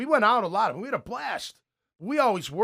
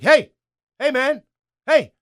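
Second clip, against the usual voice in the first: sharp loud calls, much higher; coach yelling; 7 semitones higher, 12 dB louder than usual. Retold or imitated. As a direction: bark it across the field at a player. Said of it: "Tomlin is yelling at him".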